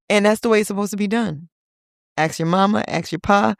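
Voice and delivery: usual speaking voice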